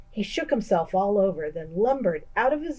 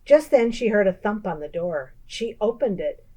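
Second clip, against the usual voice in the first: plain reading voice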